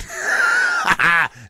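high shouted laugh; real laugh at the co-host's quip; loud burst of laughter. Real, not acted.

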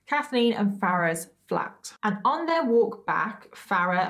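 ordinary talk, nothing marked

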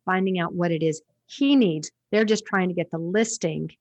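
ordinary talk

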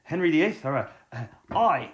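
hungover Hugh Grant. Retold or imitated. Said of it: "an actor who kinda just wakes up hungover and goes".

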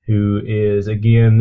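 usual speaking voice

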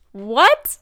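loud disbelieving what; surprised. Real, not acted. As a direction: exclaim in disbelief. Disbelief at own news.

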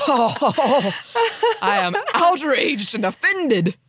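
high, slow mock-outraged Southern drawl; exaggerated Southern indignation, drawn out; cartoonish Southern accent. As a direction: play offended in a hammed-up Southern accent. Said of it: "What I mean is the cartoonish Southern accent".

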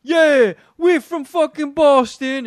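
high, loud, hammed-up Boston bro boast; obnoxious Boston bro. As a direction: imitate a brash Boston fan bragging. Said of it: "they're like".

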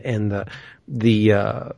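searching for the right word; trailing off, hunting for a word; fumbling for words. Real, not acted. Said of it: "what's the word I'm looking for?".

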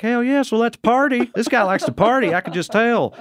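high-pitched, eager party crowd voice; hammed-up reaction of a listener won over; excited audience member. Acts out fired-up party audience.

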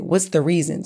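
usual speaking voice

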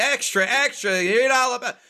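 high newsboy street cry; newsboy call. Acted. Mocking the Boston look to cohost, as a high-pitched newsboy. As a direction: hawk papers on a corner. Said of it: "like you're selling papers on the corner".

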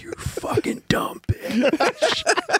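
slow, breathless whisper under a laugh; whispered insult. Retold or imitated. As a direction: hiss it slowly, barely voiced. Muttered insult at wife.

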